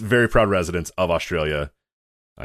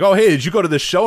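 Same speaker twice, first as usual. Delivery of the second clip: higher, louder, lightly acted curiosity; mock-eager question; 9 semitones higher, 6 dB louder than usual. Podcaster replaying asking Kevin about a show, mock-eager. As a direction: pitch it up like an excited friend asking.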